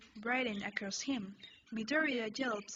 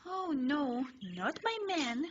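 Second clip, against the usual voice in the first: higher, dismayed cry; 3 semitones higher, slower than usual. A narrator's reading. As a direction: gasp it in dismay. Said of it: "You think".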